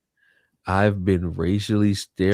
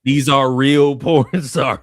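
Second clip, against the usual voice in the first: laughing; 5 semitones higher, 7 dB louder, slower than usual. Real, not acted. Laughing while insisting names are real.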